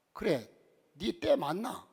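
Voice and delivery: a blunt Gyeongsang 니 때 맞나; Gyeongsang husband. Retold or imitated. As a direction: gruffly tease the wife in dialect. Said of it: "경상도 남편이 뭐라고". (퉁명스러운 경상도 사투리)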